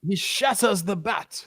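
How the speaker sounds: lightly imitating a British announcer, slow; mock British commentator. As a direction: savour each word like the Mets call. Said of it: "like he's".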